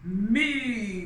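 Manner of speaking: loud booming call; booming elephant. Acted. Elephant volunteering to the king, booming call. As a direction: boom it out big. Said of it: "boomed Elephant".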